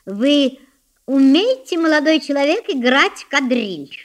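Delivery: high, loud, condescending question; contemptuous. A narrator's reading. Elder sister questions the poor boy, contemptuous. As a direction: look down on him, drawing out the end. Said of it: "спросила со своей обычной презрительной миной".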